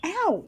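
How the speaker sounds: sudden high yelp of pain; a sharp ow as the elbow hits the desk; hit my funny bone. Real, not acted. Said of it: "I hit my funny bone".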